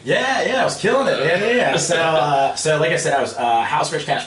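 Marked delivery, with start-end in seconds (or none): 0.1-1.8 enthusiastic yes. Real, not acted. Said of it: "emphatic enthusiastic agreement".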